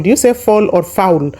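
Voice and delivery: ordinary delivery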